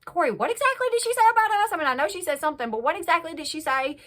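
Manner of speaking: imitating Cheyenne pushing Corey for answers; a mock pushy, fast question to Corey; pressing question. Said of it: "Cheyenne's like".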